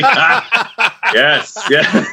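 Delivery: high, laughing yes; laughing delight. Retold or imitated. Retold delighted reaction.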